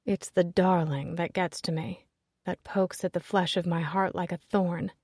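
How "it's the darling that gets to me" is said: ordinary delivery, nothing marked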